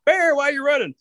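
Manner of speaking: high-pitched urgent holler; calling out. Acted. Rabbit catches up with Fox, calling out. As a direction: Rabbit hollering after a runner. Rabbit calls to running Bear.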